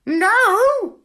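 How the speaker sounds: very high, loud parrot squawk. A narrator's reading. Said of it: "said the parrot".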